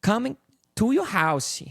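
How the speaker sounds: high, sing-song Brazilian accent, "house" as "housey". Shown as a demonstration. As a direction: Brazilian accent with an extra vowel ending "house". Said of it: "'Cause she doesn't do the".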